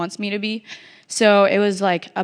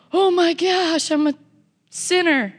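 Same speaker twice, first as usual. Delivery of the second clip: mock dramatic; 10 semitones higher than usual. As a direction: overplay the guilt for a laugh. Mocking overly emotional camp moments.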